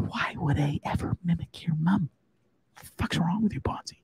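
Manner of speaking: hushed, whispery mock indignation; imitated viewer's reply. Suspected mum denying it to Bonzi, indignant mock whisper. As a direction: whisper it as an offended commenter. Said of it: "Joe Q Public's going".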